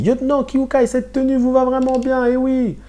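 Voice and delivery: pitched well up, teasing comrade; high teasing subtitle voice; character voice. Acted. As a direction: read it as a playful soldier. Reading a character's subtitle line.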